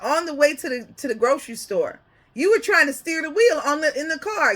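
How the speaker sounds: normal talking voice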